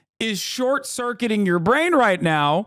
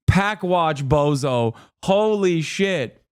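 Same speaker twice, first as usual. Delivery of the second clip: normal talking voice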